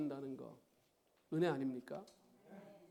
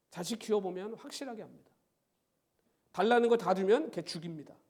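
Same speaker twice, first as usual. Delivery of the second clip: ordinary talk, nothing marked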